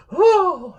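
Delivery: high, loud mock-damsel "oh"; hammed-up gasp of a fainting movie heroine; theatrical swooning cry. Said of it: "it's always like the woman's like".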